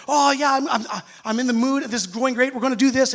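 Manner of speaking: carried away by joy; overexcited. Retold or imitated.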